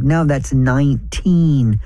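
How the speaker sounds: very slow, drawn-out number reading; dragging lottery customer. Retold or imitated. Lottery buyer dictating numbers to the clerk, maddeningly slow. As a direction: stretch out each number, imitating a dawdling customer.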